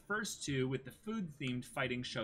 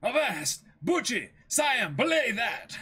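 high, loud pirate shout; reading the manga aloud, shouting pirate orders; pirate bellowing orders; 11 semitones higher, 10 dB louder, slower than usual. Acted. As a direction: bark out the captain's order across the deck. Reads Django ordering.

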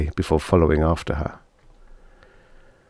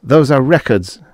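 raised, louder, restrained anger; fighting back shouting; 7 semitones higher, 5 dB louder than usual. A narrator's reading. Husband defends his books to his wife, restraining a shout. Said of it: "fighting an urge to shout".

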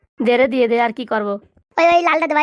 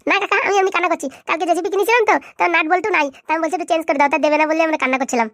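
put-on higher, sweet little-boy voice; innocent little kid; 4 semitones higher than usual. Boy plays innocent to the stranger, higher childish voice. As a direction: play the innocent small child.

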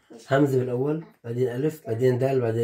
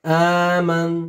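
very slow, drawn-out sound; dictating; 2 semitones higher, 3 dB louder, much slower than usual. Shown as a demonstration. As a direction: stretch the first sound for a child.

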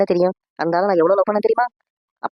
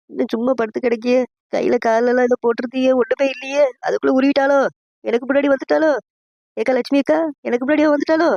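high-pitched anxious wail; acted fretting; 6 semitones higher than usual. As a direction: fret aloud that a rival got there first. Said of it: "அதுக்கு feel பண்ணிட்டு இருக்க". (பதற்றமான உயர்ந்த குரல் புலம்பல்)

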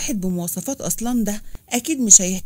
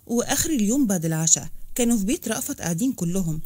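plain reading voice

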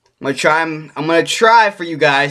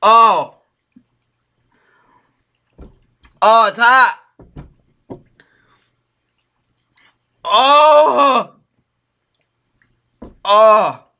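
high strained cries from the burning sauce; pained burning gasps; 7 semitones higher, 3 dB louder, much slower than usual. Real, not acted. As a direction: gasp in real pain. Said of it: "My mouth is burning".

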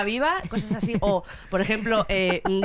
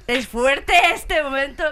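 dramatic reveal; 6 semitones higher, 6 dB louder, slower than usual. Acted. Reacting to gossip reveal.

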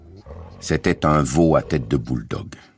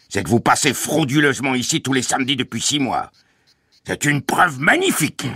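high, triumphant bully's voice; sarcastic guard; 8 semitones higher than usual. A narrator's reading. Guard has written proof of trespassing, gloating sarcasm. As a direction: crow the accusation, high and strained. Guard mocks and accuses the family.

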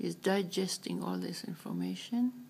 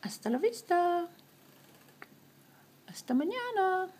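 high, sing-song goodbyes; 8 semitones higher than usual. Acted.

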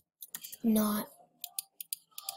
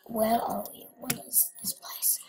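awed whisper; 6 dB quieter than usual. Real, not acted. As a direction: whisper in wonder. Entering a new map.

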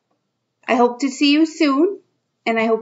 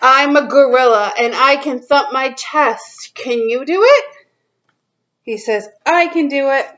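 put-on reading to children; 3 semitones higher than usual. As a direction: read it out to little kids, drawing each line out. Reads picture book to kids.

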